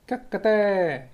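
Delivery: high crow call, imitating a hen's cackle; chicken crowing. Teacher imitating a chicken crowing for his listeners. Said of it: "They hear a chicken crowing".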